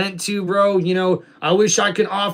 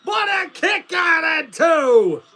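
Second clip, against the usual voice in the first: higher, excited wrestling commentator call; play-by-play commentary; 9 semitones higher, slower than usual. Acted. Streamer calling the wrestling game for chat, excited play-by-play. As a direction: call the match like a TV announcer.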